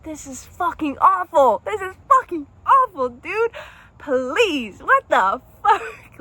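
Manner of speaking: high, loud, tearful pleading on the phone; half-laughing, half-crying desperate call; crying plea. Retold or imitated. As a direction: beg him through tears, voice cracking high. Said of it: "sitting there crying and calling".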